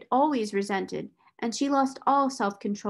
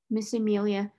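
ordinary delivery, nothing marked

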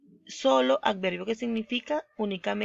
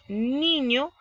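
"Niño" raised, weight on the first syllable NI; stress on NI; 4 semitones higher than usual. Shown as a demonstration. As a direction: lean on NI in "Niño". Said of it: "ni, porque lleva la mayor fuerza de voz".

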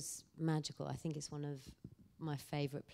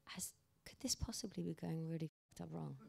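voiceless, hushed inner worry; anxious whisper; 4 dB quieter than usual. Retold or imitated. Driven off in a strange van, whispering her panic. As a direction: whisper it like a fear you daren't say aloud.

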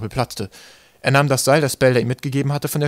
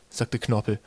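usual speaking voice

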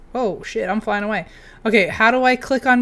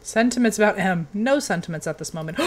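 ordinary talk, nothing marked

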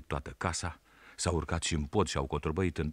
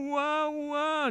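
very high wailing ua ua; boy imitating his little brother's wail; baby crying imitation. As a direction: mimic a crying toddler. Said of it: "Când începe a plânge".

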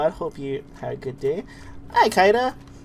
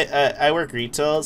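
ordinary delivery

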